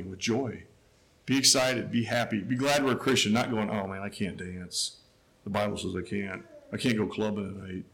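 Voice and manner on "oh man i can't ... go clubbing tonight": quiet mock lament over missing the club. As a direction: whine like a grumbling churchgoer.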